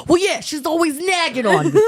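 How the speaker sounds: high-pitched, laughing gripe; mock complaint. Husband griping to the therapist about his wife, mock exasperated.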